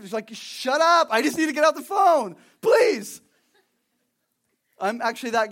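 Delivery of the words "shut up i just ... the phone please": loud, high and fed up, almost yelling; exasperated shout. Retold or imitated. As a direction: snap at the endless talker to let you go. Fed up with a chatty caller.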